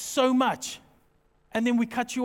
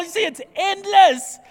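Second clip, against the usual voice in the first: ordinary talk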